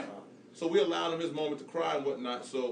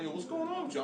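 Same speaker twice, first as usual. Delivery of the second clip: a much higher, worried "what's going on"; concerned surprise; 13 semitones higher, 4 dB quieter than usual. Retold or imitated. Dad asking his crying son what happened, in high-pitched concern. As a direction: ask the son, puzzled and alarmed. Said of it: "I'm looking at him like".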